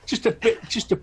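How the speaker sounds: laughing. Acted. Character laughs it off.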